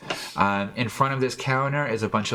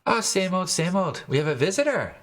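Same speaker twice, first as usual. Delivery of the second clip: a hint of a merry barmaid; tipsy barmaid; 9 semitones higher than usual. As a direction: lilt it like a happy barmaid. GM voices Heather.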